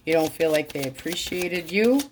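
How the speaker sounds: ordinary talk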